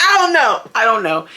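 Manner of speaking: amused, squealed disclaimer; laughing. Real, not acted. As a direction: laugh it off, pitch shooting up. Laughing at her own speculation.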